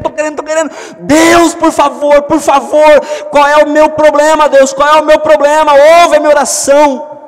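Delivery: high, loud desperate pleading prayer; anguished cry to God. Acted. Acted anguished prayer crying to God. (clamor angustiado a Deus, alto e agudo)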